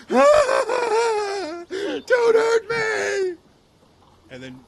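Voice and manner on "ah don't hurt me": high, loud shrieking cry for mercy; panicked leader. Acted. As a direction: scream it in hammed-up terror, drawn out. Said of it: "the leader of this adventuring group going".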